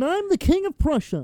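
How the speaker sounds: high, hammy royal voice; pompous king voice. Pretend. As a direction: play a grand king welcoming guests. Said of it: "That's not how he sounded".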